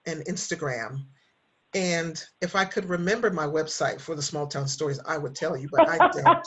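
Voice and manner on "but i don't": high, loud laugh through the words. Real, not acted. Host laughing at her own forgetfulness. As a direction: laugh it off, embarrassed.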